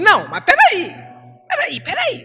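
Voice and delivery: quick high-pitched cutting in; impatient interrupting. Retold or imitated. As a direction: cut them off, impatient. Interrompendo o cônjuge. (interrompendo, impaciente e aguda)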